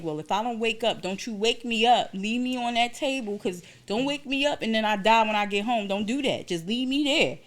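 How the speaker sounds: dramatized pleading instructions; retold insistent plea. Acting out pleading with doctor.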